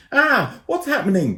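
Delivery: high, loud panicked cry; scared scream. A narrator's reading. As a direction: scream in fright mid-air. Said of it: "Ben screamed".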